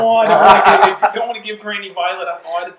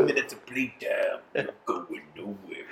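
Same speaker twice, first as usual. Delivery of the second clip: deep mock menace; 13 semitones lower, 10 dB quieter than usual.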